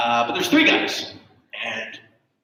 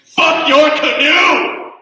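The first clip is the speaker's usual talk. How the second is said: high, slow, full-volume scream; defiant scream; 13 semitones higher, 10 dB louder, much slower than usual. Acted. Dying American screaming at the king, high and loud. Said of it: "he just screams".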